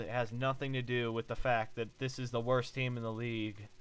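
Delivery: usual speaking voice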